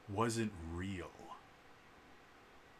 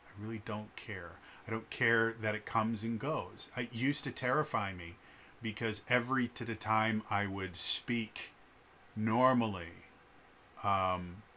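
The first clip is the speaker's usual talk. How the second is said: ordinary talk, nothing marked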